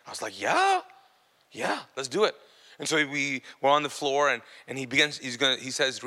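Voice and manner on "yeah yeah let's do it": high, rising squeak on yeah; squeaky retold agreement; retold eager yes. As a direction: yeah pitched way up, questioning. Retold hesitant agreement to pray.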